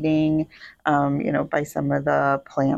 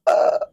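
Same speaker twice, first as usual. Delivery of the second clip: disgusted groan; 5 dB louder than usual. Retold or imitated. Reacting to gross tissues.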